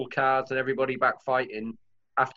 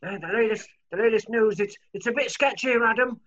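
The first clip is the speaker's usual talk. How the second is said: higher-pitched field reporter bit; mock war correspondent; 6 semitones higher than usual. Joking war correspondent reporting to camera, put-on high voice. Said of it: "I feel like a war correspondent".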